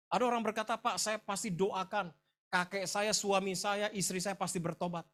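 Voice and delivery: high, loud pulpit challenge; impassioned preacher building to a rebuke; forceful preaching. Real, not acted.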